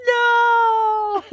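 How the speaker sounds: loud dismayed no; dismayed shout. Real, not acted. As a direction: cry out in dismay. Realizing she sneezed in recording.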